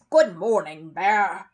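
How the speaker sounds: loud gruff greeting over the fence; neighbour's voice. A narrator's reading. Neighbour calls over the fence to the bear, loud. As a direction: call out like a surly neighbour.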